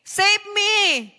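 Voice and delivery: loud, high desperate cry for help; Peter's shout. Acted. Preacher voicing sinking Peter shouting to the Lord. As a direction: yell it out like a sinking man. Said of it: "sumigaw siya".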